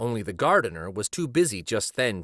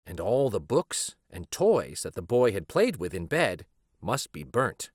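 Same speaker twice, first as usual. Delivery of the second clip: ordinary delivery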